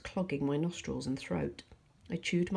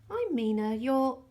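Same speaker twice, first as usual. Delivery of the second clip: higher and louder, bored sing-song; 9 semitones higher, 8 dB louder, slower than usual. A narrator's reading. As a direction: chant it like she's bored of saying it. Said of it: "in a bored-sounding sing-song voice".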